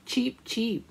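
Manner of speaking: plain reading voice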